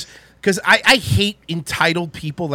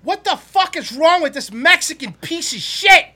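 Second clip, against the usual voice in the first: much higher, heated retelling of the rant; retold angry outburst; 9 semitones higher, 4 dB louder than usual. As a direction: replay your fury at the guy, pitched way up. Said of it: "she knows it was loud".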